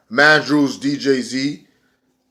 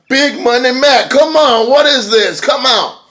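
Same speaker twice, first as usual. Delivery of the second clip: high, loud wrestler call-out, hammed-up; calling his brother out to the ring, much higher and louder; mock call-out; 12 semitones higher, 6 dB louder than usual. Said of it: "So he calls out".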